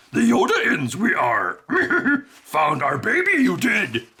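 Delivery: mock Yoda speak with laughs. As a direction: do a laughing, backwards-talking Yoda. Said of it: "It'll be like".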